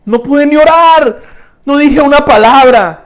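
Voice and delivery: high, overwhelmed lament; acted anguished cry. Worshipper confessing he could not even pray, acted anguish. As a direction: cry it out like a worshipper lost for words.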